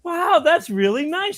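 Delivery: high, drawn-out mock admiration; indulgent old lady. Old lady praising the pirate costume, high and indulgent. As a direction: draw it out sweetly high for a child.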